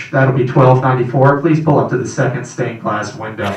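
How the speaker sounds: loud, low drive-through upsell; speaker box clerk. Pretend. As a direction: ask like a fast-food intercom.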